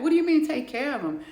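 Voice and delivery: high, loud and fast disbelief; shocked shriek. Acted. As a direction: shriek it out in horror. Shocked at his request.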